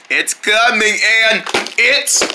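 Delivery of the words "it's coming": ordinary talk, nothing marked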